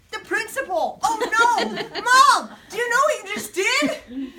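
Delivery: high, loud cries of dismay; horrified outburst. Acted. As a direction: call out to Mom, aghast.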